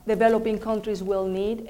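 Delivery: ordinary talk, nothing marked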